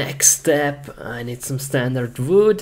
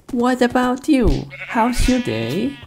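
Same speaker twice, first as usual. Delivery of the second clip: pitched way up, sweet and silly; high sing-song voice to animals; 11 semitones higher than usual. Acted. Player cooing at the sheep in a high silly voice. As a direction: coo at the animals in a much higher voice.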